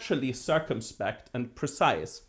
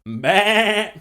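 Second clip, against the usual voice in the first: a loud, nasal-free mock sheep's bleat, pitched up; 5 semitones higher, 9 dB louder than usual. Lecturer telling a joke to students, imitating the sheep's loud bleat. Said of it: "and goes".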